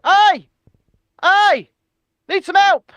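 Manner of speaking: high, loud hailing call; shouting out. Pretend. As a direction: yell it across the water. Calling out from the harbour.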